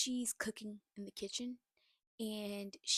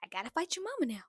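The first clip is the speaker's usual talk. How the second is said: louder, higher, rising outrage; real irritation; 4 semitones higher, 8 dB louder, faster than usual. Real, not acted. Vlogger reacting to the mother-in-law, heated loud outrage. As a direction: build up heated disbelief. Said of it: "that whole conversation just irritated me".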